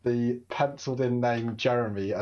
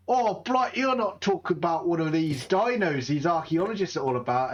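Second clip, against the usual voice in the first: higher, eager British explorer voice; mock posh thrill at dinosaurs; excited British explorer; 4 semitones higher than usual. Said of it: "Be like".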